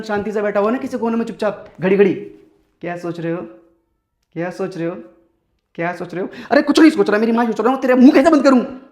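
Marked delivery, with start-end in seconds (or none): 2.1-6.3 very soft, low mock questioning; quiet, insistent needling, mimicked; mimicked prying girlfriend. Imitating her repeated prying question. 6.5-8.8 acted burst of irritation; exasperated outburst. Exploding at the repeated question.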